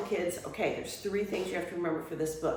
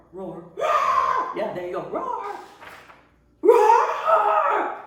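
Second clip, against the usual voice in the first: each roar higher and louder than the last; roar getting louder; 13 semitones higher, 10 dB louder, much slower than usual. Shown as a demonstration. Librarian shows kids how the roar grows, building to a shout. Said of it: "the roar gets louder as it goes".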